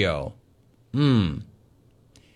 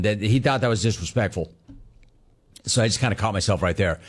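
ordinary talk

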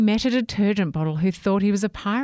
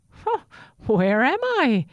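confused; 8 semitones higher, 4 dB louder than usual. A narrator's reading. Toothbrush washed ashore asking the dancers where he is. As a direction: wake up lost and puzzled. Sammy washing up lost.

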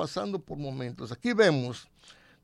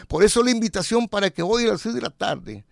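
louder, urgent invitation; preached announcement; 3 semitones higher, 5 dB louder than usual. Acted. Preacher invites the men listening, louder. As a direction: call the men in like an altar call.

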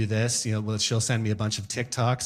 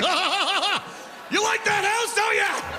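teasing the house; much slower than usual. Retold or imitated. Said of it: "I'm like".

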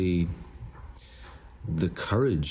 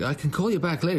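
lightly acted caller, pitched up; retold phone reply in a higher voice; 10 semitones higher, faster than usual. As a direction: act out an offer to ring back. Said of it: "[name]'s like".

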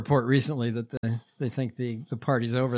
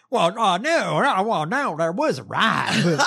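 higher, louder flustered denial; mocking imitation; 4 semitones higher, 4 dB louder, slower than usual. Politician dodging the reporter's question, mockingly imitated. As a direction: stammer out a hammed-up backpedal. Mimics the politician's stammered answer.

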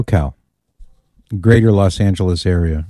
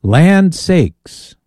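Southern exclamation; 8 semitones higher than usual. Acted. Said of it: "your voice getting Southern sounding".

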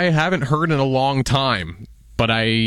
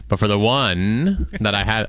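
normal talking voice

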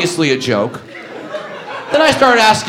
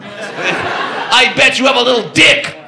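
laughing taunt; 5 semitones higher, slower than usual. Retold or imitated.

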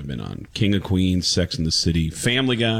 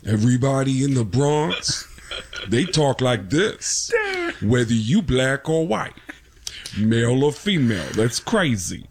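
Tracy Morgan impression; 2 semitones higher than usual. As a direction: do a Tracy Morgan bit, unhurried and punchy. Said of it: "sounds like Tracy Morgan".

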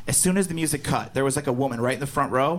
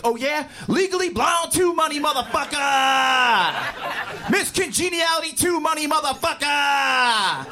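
high hammed-up boast, endings stretched out; mock headliner's boast; 7 semitones higher, slower than usual. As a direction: holler each movie title as a comeback. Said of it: "run on stage and be like".

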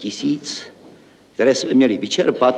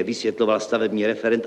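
ordinary talk

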